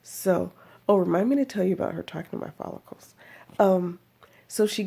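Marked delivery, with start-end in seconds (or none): none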